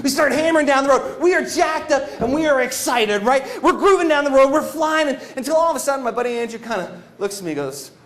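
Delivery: ordinary talk, nothing marked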